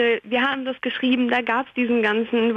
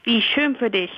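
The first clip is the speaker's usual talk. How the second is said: ordinary delivery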